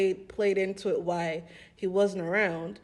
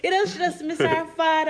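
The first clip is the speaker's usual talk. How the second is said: friend's mom, repeated; 8 semitones higher, 5 dB louder than usual. Retold or imitated. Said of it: "she was like".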